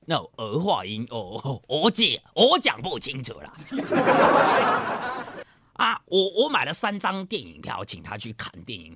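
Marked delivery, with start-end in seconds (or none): none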